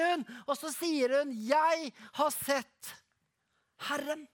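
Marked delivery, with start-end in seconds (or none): 1.3-4.3 slow, joyful proclamation; jubilant, drawn-out declaration; exclaiming in pure joy. A narrator's reading. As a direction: announce it to the disciples, overjoyed. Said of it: "i rein glede så utbryter hun".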